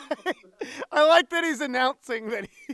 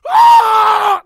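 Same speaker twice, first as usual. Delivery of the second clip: high, very loud held yell; scream going up; 8 semitones higher, 10 dB louder than usual. Acted. Actor retrying the scream, pitch going up. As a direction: let the scream climb. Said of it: "I don't know why I go up".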